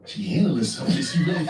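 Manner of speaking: disbelieving echo of his words; incredulous inner voice. Retold or imitated. Caller thinking over the man's excuse, incredulous. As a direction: repeat it as a scandalized question. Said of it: "I'm saying in my mind".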